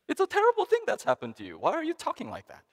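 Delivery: high, fast, incredulous scolding; exasperated disbelief. Retold or imitated. Speaker scolds his laughing friend, high fast exasperated disbelief. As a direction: fire it back fast, stunned he's laughing. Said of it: "and said".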